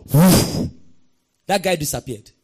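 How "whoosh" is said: loud rushing gust sound; imitated whoosh of air; wind whoosh. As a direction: blow it out like a sudden wind. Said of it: "like wind".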